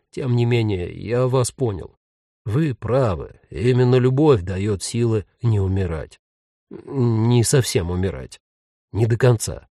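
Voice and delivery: subdued ghost with halting phrases; lightly voiced ghost, pensive. A narrator's reading. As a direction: muse in short, separated phrases. Ghost's voice in dialogue.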